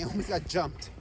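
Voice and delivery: usual speaking voice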